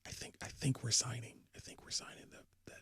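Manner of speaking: hushed secret whisper. Real, not acted. Whispering a transfer rumour to cohosts, hushed. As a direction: keep it down, it's a secret. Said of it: "I don't know if we've ever whispered like this".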